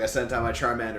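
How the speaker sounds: ordinary talk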